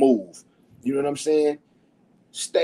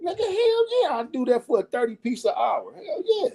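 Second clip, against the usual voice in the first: high, excited yelling of his own reaction; hyped-up disbelief at thirty an hour; excited retelling. Retold or imitated. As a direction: replay the thrill of the offer, pitched way up. Retold excited reaction to job offer.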